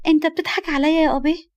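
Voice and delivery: put-on high, childish questioning voice; little girl. Little girl asking whether she's laughed at, childish. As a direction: ask like a miffed small child.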